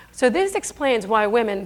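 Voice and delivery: ordinary talk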